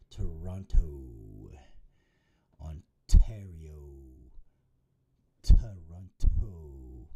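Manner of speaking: slow, loud, stretched words; drawn out. Real, not acted. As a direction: stretch each word out loudly. Drawn-out announcement of location.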